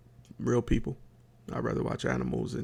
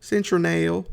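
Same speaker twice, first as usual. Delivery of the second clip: higher, louder imitated country twang; "Centennial" sung out in a raised country twang; 6 semitones higher, 5 dB louder, slower than usual. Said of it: "that's how they say it".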